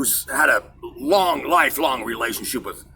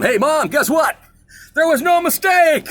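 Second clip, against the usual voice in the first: excited; 13 semitones higher, 4 dB louder than usual. Retold or imitated. Telling mom the good news.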